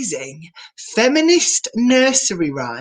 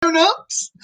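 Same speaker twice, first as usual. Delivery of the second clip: excited greeting; 10 semitones higher, 3 dB louder than usual. Real, not acted. Host greets children and adults.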